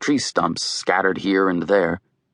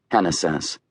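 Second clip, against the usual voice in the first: ordinary delivery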